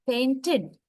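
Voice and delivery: "painted" ending in a clear "id", "pain-tid"; careful model of "painted", second syllable "tid". Shown as a demonstration. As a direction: say "painted" with a short i before the final d. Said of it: "So it's like id sound".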